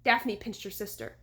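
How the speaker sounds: ordinary delivery, nothing marked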